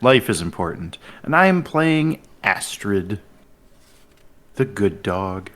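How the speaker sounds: normal talking voice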